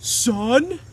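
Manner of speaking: cheery sitcom dad. Retold or imitated. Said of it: "This is my impression of Brian".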